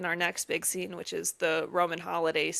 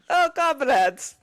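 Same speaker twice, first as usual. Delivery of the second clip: slow, hammed-up Russian accent; fake Russian accent; 7 semitones higher, 5 dB louder, slower than usual. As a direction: put on a cheesy Russian accent for the comrades. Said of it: "fake Russian accent".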